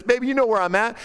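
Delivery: mock-flirty tease; teasing. Solomon teasing his wife about where to find him, playfully high. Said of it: "he teases her and says".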